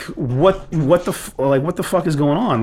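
venting on the phone to the area manager, exasperated; retold angry outburst. Angry call to contractor's manager.

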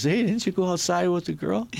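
high mock bouncer voice, lightly voiced; imitating the bouncer. Retold bouncer confronting him.

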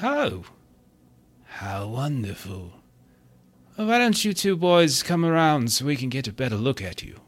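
game master's put-on host voice; party host character.

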